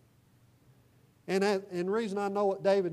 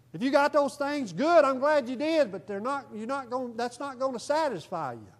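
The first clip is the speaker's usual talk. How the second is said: preaching hard; 6 semitones higher, 6 dB louder than usual. Real, not acted. Preacher rising to full force.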